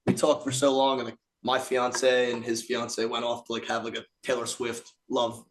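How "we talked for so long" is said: usual speaking voice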